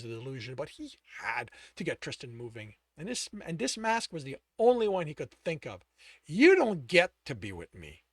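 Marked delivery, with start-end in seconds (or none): 6.3-8.0 cold rejection. Acted. Alex coldly rejecting.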